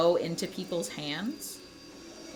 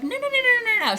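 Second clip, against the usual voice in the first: high, loud string of rapid denials; mock protest; 9 dB louder, faster than usual. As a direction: imitate a wife's squealed, insistent objection. Acts out wife's quick objection.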